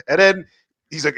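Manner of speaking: ordinary talk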